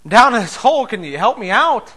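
high, loud call for help; shouting up from a hole. Acted. Man in the hole shouting up to the doctor, loud and high. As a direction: yell up to the doctor above. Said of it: "guy shouts up and says".

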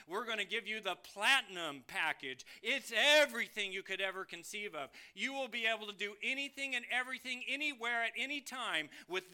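lively, building mock sales pitch; salesman pitch. Salesman offering ever bigger packages, eager mock pitch. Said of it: "And then he'll go".

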